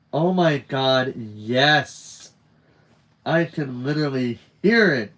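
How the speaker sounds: loud, emphatic delight; enthusiastic, punched-up agreement with a friend; excited agreement. Acted. Friend delightedly agreeing in story dialogue.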